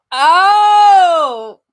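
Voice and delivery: high, loud startled oh; a sudden loud gasp of surprise; surprised oh. Real, not acted. Streamer startled by her screen, loud surprised oh.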